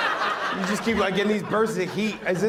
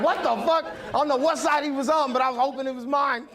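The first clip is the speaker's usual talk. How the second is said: shocked; 10 semitones higher than usual. Retold or imitated. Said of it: "I'm like".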